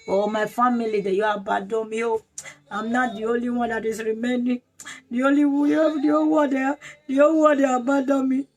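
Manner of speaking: put-on tearful wailing, rising as it goes; mock crying. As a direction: act out a self-pitying lament, building in pitch. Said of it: "you don't need to cry".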